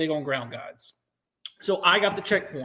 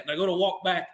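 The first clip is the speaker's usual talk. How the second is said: ordinary talk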